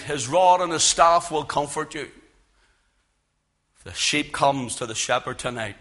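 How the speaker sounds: normal talking voice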